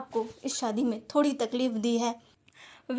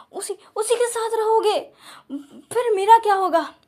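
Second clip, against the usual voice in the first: jealous ranting; 3 semitones higher, 4 dB louder than usual. Acted. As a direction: pour out jealous desperation. Wang rants jealously, rising. (जलन भरी, रोती हुई ऊँची आवाज़)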